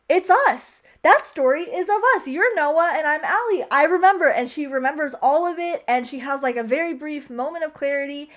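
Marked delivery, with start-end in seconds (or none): 0.1-4.3 higher, louder excited retelling; sudden recognition. Retold or imitated. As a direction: blurt out the old lady's sudden realization, acted. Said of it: "the old lady remembers and is like".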